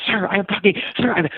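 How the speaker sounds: put-on high-pitched persistent kid voice; a light boyish nagging voice; imitating the kid. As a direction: pester like an eager boy on a scooter. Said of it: "finally, I slammed on my brakes".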